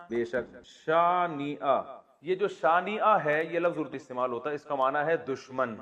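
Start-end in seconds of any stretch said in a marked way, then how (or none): none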